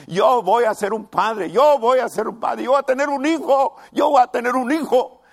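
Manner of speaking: loud, high, jubilant shouting; excited announcement. Acted. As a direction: crow it to the whole neighbourhood, overjoyed. Abraham telling neighbours he'll be father. (Abraham anunciando a los vecinos, gritando de alegría)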